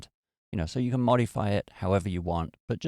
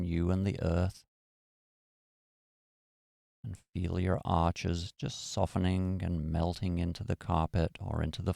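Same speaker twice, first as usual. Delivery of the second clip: guided meditation. Acted. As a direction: ease them into stillness. Leads a meditation.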